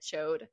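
CHODE, one syllable ending in D; "Chode" rhyming with road; added D. Shown as a demonstration. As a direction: say "Chode" with a D tacked on the end. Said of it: "I just added the D".

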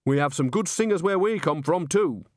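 a touch of stubborn defiance; rebellious. A narrator's reading. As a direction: stand up for your hometown. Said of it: "said Nanny Ogg rebelliously".

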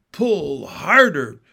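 loud bellowed command; roaring king. A narrator's reading. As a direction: roar it out like a king. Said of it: "roared the king".

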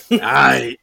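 amused, breaking into laughter; laughing. Real, not acted. Laughing at own repeated reply.

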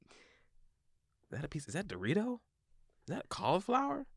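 hushed, puzzled questions rising high; baffled scrutiny. Retold or imitated. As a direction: peer at it and ask in soft disbelief. Acts out puzzling over plate.

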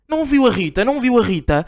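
lightly hammed-up parent asking for his daughter; mock shouting parent. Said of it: "há mais um pai a gritar ao meu ouvido".

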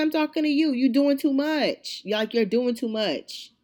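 heated retold telling-off; retold confrontation. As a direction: argue right back, stretching the words. Acted quarrel, speaker answers back.

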